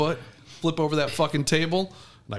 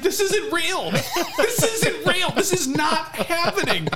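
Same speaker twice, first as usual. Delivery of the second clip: high, drawn-out disbelieving wail; imitating hysterical laughter; 4 dB louder, much slower than usual. Friend with a spider on him, hysterical disbelief. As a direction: wail it slowly, giddy and high. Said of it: "he's laughing hysterically".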